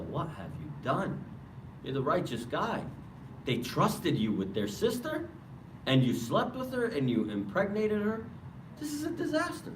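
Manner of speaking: rising mock outrage at a fallen righteous man; acted reproach. Iblis confronting the worshiper over his misdeed, reproachful.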